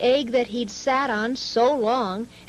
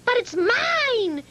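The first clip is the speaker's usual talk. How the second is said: much higher, shrill-pitched claim; screaming bird; 11 semitones higher than usual. A narrator's reading. Maisie claiming the hatching egg, screaming high. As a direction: scream to snatch it back. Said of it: "screamed the bird".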